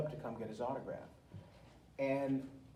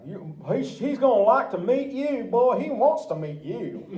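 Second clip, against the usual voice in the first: higher, louder, slow mock-threat; menacing henchmen; 10 semitones higher, 11 dB louder than usual. Goons in the dark trailer to Tony, a hammed-up higher threatening voice. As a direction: ham up the trailer goons, slowly.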